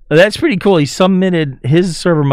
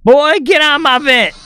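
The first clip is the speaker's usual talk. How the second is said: character voice; 12 semitones higher than usual. Acted. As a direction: shout it like an angry cartoon figure. Voicing a character in cutscene.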